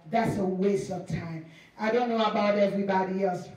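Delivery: normal talking voice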